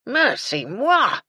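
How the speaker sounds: loud, slow, outraged cry; irate. A narrator's reading. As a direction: exclaim in outrage. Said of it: "said an irate voice".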